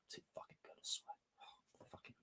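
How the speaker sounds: breathless whisper of delight; awed whisper. Real, not acted. Streamer overwhelmed by a great scene, whispering in awe.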